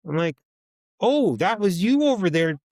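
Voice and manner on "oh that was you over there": high, loud sarcastic jab; loud mocking taunt. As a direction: needle him for twirling his arms. Mocking him to his face.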